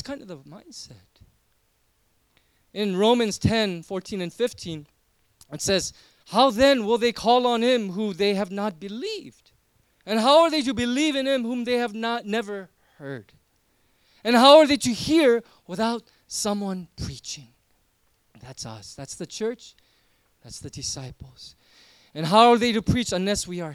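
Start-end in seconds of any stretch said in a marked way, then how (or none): 6.3-17.5 loud, urgent preacher's push; impassioned preaching. Acted. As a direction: drive it home to the congregation. Reading scripture with force.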